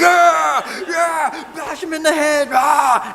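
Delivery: high, loud yelling at the fight; screaming fan cheering a knockout; fan shouting. Pretend. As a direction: roar at the TV like a rabid fan. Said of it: "You're like".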